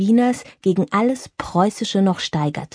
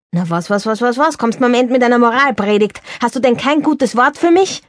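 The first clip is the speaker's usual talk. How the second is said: fast, higher, louder protesting; indignant Girardi; 4 semitones higher, 4 dB louder than usual. Acted. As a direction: brush off the sermon with quick indignation. Girardi defensive. (Girardi wehrt Berthas Moralpredigt ab, empört und schnell)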